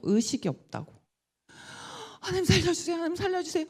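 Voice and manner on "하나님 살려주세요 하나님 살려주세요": high, pleading cry to God; a frantic repeated prayer, voice pitched high; desperate plea. Retold or imitated. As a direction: beg God to save the child.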